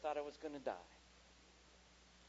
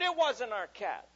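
high, loud, slow insistence; imitating a child's protest; fibbing child; 7 semitones higher, 12 dB louder, slower than usual. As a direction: dig in stubbornly like a kid.

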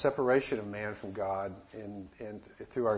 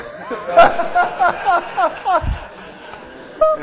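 high, loud burst of laughter; big real laugh; 14 dB louder than usual. Real, not acted. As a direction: crack up helplessly. Laughing at Roman's cigarette.

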